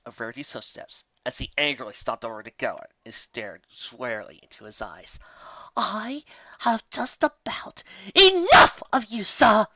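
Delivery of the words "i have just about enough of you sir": slow, loud, seething words; shouted. A narrator's reading. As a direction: let the anger boil out slowly. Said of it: "she shouted in his face".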